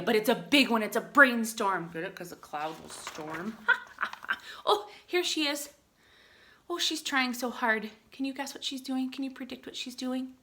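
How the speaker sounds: normal talking voice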